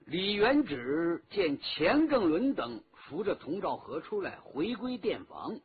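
usual speaking voice

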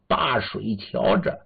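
plain reading voice

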